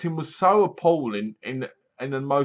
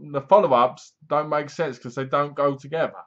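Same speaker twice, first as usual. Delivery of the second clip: usual speaking voice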